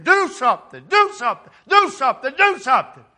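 loud, high, pleading "do something"; the spirit rising, shouting inside him; urgent shouting. Retold or imitated. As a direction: cry out over and over, urgent. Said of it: "His spirit ... saying".

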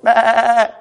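playful pretend sheep asking to witness; mock sheep missionary at the door; talking sheep. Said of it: "I don't know how they might talk".

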